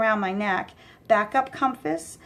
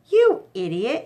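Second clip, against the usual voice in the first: loud angry snap; angry insult; 3 semitones higher, 5 dB louder than usual. Acted. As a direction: snap at your partner. Angry goon.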